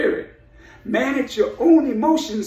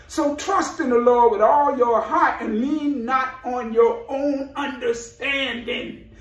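ordinary talk, nothing marked

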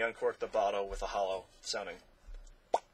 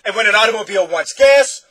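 loud, high shouted prompt; roared question; 10 semitones higher, 20 dB louder than usual. A narrator's reading. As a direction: shout it to whip up excitement. Doctor keeps roaring his questions.

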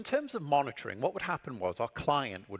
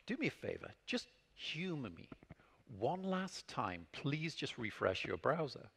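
patient coaxing; 9 dB quieter than usual. Retold or imitated. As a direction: humor the client gently, dragging it out. Stalling client with patient request.

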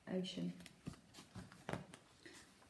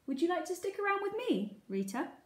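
high, quick, beaming invitation; bright with a huge grin, rushing ahead; grinning; 10 semitones higher, faster than usual. A narrator's reading. Gilbert invites Rita to stay with him, grinning. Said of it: "grinned a huge grin".

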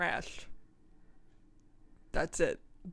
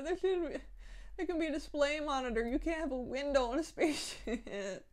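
usual speaking voice

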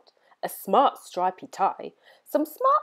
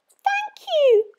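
very high squeaky mouse thanks; 5 dB louder than usual. A narrator's reading. Mice thanking George, very high squeak. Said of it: "they squeaked".

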